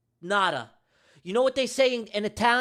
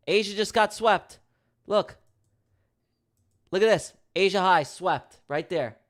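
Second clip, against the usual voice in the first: normal talking voice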